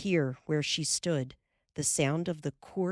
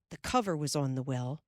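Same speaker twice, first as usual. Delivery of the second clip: usual speaking voice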